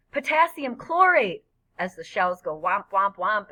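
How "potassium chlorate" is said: high, loud, stretched-out call; excited shout. A narrator's reading. Freak naming the shells as they go off, excited shout. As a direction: shout out each burst's chemical. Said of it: "he shouts".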